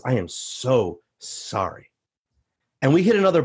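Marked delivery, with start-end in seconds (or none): 0.0-1.9 low, very slow, contrite; drawn-out mock-sorrowful apology; sad apology. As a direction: imitate a chastened man apologizing. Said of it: "sad look on his face".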